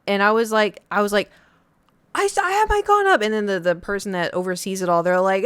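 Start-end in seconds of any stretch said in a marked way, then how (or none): 2.1-3.2 very high, loud panicked yell; desperate protest. Retold or imitated. Kid yelling at shooters while they keep firing, high panicked yell. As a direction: shriek it, pleading to be left alone.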